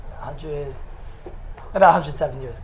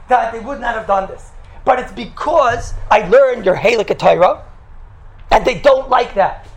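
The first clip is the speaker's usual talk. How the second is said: loud, higher-pitched denunciation; impassioned public declaration; 4 semitones higher, 6 dB louder than usual. Retold or imitated. Rabbi denouncing his opponents before God in shul, loud impassioned declaration. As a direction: proclaim each grievance forcefully, building the case.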